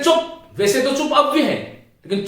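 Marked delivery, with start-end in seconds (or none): 0.0-0.4 चुप snapped sharply and high; angry hush. Shown as a demonstration. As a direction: cut someone off in anger. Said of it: "क्रोध में हम किसी को कहते हैं".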